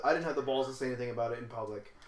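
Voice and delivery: normal talking voice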